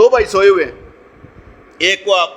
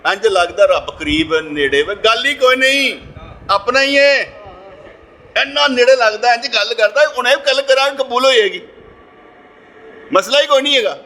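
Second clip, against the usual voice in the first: expansive spiritual elation; 6 semitones higher than usual. Acted. Preacher shows how close God feels, acted elation. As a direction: act out joyful certainty in a raised voice. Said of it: "کچھ ہوتی ہیں بے ست کی حالت".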